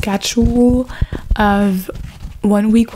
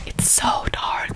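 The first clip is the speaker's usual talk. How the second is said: whispering; 10 dB quieter, slower than usual. Acted. Whispered aside.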